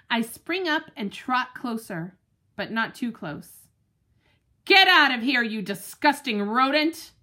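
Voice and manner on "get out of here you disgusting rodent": loud, fierce guard-dog bark; barked warning. Acted. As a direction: drive the squirrel off. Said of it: "I bark".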